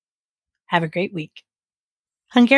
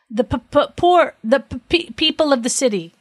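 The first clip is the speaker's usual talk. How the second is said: higher, nervous stutter on the p's; stammering; 7 semitones higher than usual. A narrator's reading. Judge finally confessing to the king, stammering. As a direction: stumble and stutter over the first sounds. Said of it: "stammered the judge finally".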